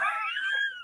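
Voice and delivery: high squeaky giggle; squealing laugh. Real, not acted. Host cracking up over roach car, squealing high. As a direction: let the giggle escape high.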